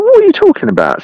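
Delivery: very high disbelieving cry; incredulous squeak. Retold or imitated. Baffled angler squeals back at his allergic friend.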